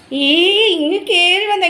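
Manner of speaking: high, slow coaxing call. Real, not acted. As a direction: invite the dog in gently. Calling dog inside.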